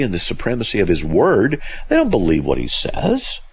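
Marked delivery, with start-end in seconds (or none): none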